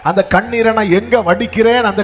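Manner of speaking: high, driving preacher's voice; impassioned preaching. Acted. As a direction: build urgently to a call for amens. Preaches at a climax. (ஆவேசமான பிரசங்கம்)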